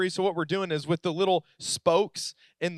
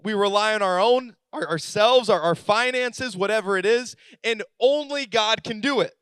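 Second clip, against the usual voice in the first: rising, louder preaching build; 3 semitones higher, 3 dB louder than usual. Acted. Pastor urges congregation to rely on God, preaching. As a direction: press the point home to the congregation.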